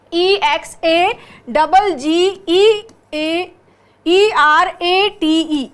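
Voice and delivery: very slow, letter by letter; spelling out. Shown as a demonstration. Teacher spelling exaggerate for students, slow spelling out. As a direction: spell it out slowly, dwelling on each letter.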